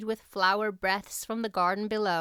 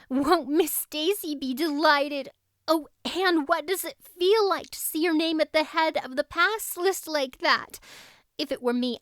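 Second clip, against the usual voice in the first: higher, louder chattering schoolgirl; best friend babbling with delight; excited girl friend; 6 semitones higher, 3 dB louder than usual. Acted. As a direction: gush the news nonstop. Diana's long excited speech.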